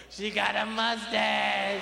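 put-on slow childish whine; blunt kid. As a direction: drag it out like a little kid. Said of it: "They go".

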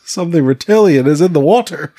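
exaggerated American accent through laughter; mock American accent. Co-host redoes the crew's quote on request, laughing American accent. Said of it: "but in a more American accent".

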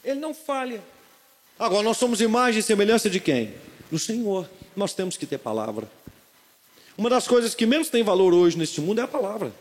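pregando com fervor; pregação. Acted. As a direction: pregue com convicção. (pregando à igreja com fervor)

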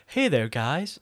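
put-on casual American accent. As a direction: greet them like an imagined Americanized Arnie. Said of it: "Arnie's got an American accent".

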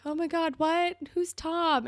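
high-pitched mock panic; imitated freak-out. Marco hearing Dylan invited Tom, mock freaked out. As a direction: jump up high in flustered alarm. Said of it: "freaked out".

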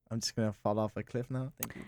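unvoiced embarrassed whisper. Real, not acted. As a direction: whisper it in defeat. Embarrassed joking aside.

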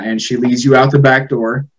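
ordinary talk

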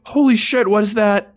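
high, loud shout of alarm; panicked scream. Acted. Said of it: "he screams".

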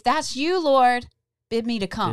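loud pleading prayer; bold prayer. Pretend. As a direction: call out boldly like Peter. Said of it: "I wanna say".

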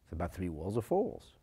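quick, low, dismissive objection; mock student protest. Teacher voicing a student who finds the question obvious, quick and dismissive. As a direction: toss it off as an impatient student. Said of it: "You're gonna say".

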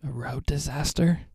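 whispered, amused question. Real, not acted. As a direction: breathe it back in disbelief. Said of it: "That is a statement".

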